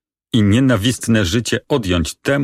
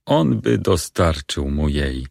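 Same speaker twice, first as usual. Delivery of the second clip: ordinary talk, nothing marked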